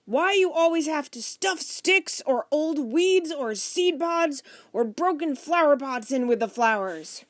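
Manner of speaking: exasperated shouting. A narrator's reading. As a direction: force the thought out over everyone, loudly. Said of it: "Mister Franklin shouted louder than anyone".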